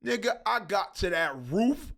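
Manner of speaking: ordinary talk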